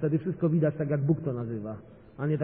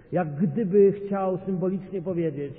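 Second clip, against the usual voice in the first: ordinary talk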